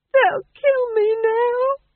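high, slow crying wail. Acted. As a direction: sob it out in despair. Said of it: "he wailed".

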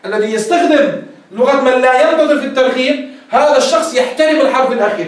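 ordinary talk

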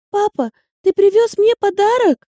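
put-on high, eager child's question; a little girl hoping for a gift; excited little girl. A narrator's reading. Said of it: "воскликнула девочка".

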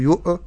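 "يؤ" with a small vowel released after the stop; moving hamza. Shown as a demonstration. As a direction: add an echo vowel after "يؤ". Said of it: "ولا أحرك الهمزة". ("يؤ" بتحريك الهمزة)

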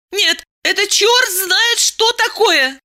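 high-pitched outraged woman; indignant cry. Acted. Nervous woman protests to her lover, crying out. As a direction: cry out high, without much extra volume. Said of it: "крикнула она".

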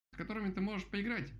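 high, eager exclamation; exclaiming. A narrator's reading. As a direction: pipe up excitedly as a story woodpecker. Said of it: "воскликнул дятел". (радостный возглас высоким голосом)

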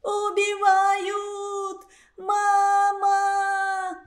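высокий, протяжный крик ужаса; вопить в ужасе, растягивая; истошный крик. Acted. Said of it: "закричала страшным голосом". (страшный крик)

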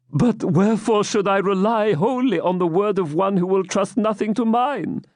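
bow and beg; timid old man. Acted. Captive begging the baron, timid. Isaac pleading.

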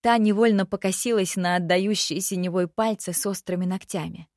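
ordinary delivery

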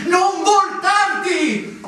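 urgent plea. Acted. Urgent command to himself, slowed.

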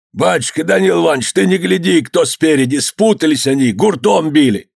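high, insistent appeal; urgent pleading. A narrator's reading. Nadeika begging master for the workers, high urgent pleading.

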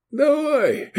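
very high, tipsy hiccup of assent. A narrator's reading. Tipsy owner agreeing to dice, hiccuping. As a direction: let a hiccup jolt the word upward. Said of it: "икнул Бланиста".

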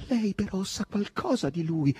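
slow, high pleading; slow, beseeching question; imploring. A narrator's reading. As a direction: beg for it, pitched high. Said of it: "sussurrò Margherita con tono implorante".

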